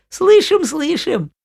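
high, loud little goats; squeaky children shouting back; kid goats voice. Acted. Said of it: "А козлята отвечают".

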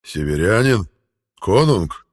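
loud but unsure rumble; a hint of doubt in the giant; uncertain knight. A narrator's reading. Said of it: "нотки неуверенности".

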